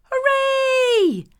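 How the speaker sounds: high excited shout of joy; joyful cheer. A narrator's reading. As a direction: cry out hooray with delight. Lazy farmer cheers at sunshine.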